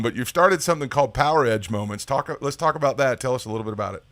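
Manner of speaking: normal talking voice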